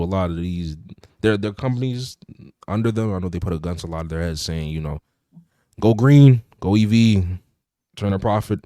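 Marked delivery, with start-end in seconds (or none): 5.8-8.6 loud, punched-out commands, easing off by the end; curt ultimatum. Retold or imitated. Parent company giving orders to its brands, curt ultimatum. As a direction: lay down the terms like a boss.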